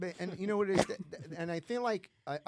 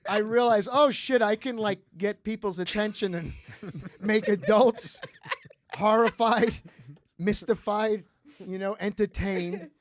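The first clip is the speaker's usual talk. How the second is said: excited; 7 semitones higher, 12 dB louder than usual. Real, not acted. Excited retelling.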